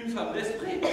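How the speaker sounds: ordinary talk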